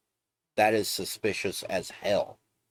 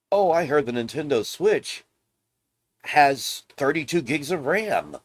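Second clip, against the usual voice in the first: mock rumor spreader; 6 semitones higher, 4 dB louder than usual. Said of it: "a lot of people take it as gospel".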